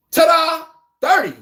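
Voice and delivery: loud, high ta-da; triumphant flourish; showman's reveal. Acted. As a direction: present it like a magic trick. Reveals the thirty-point math.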